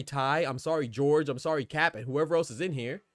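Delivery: normal talking voice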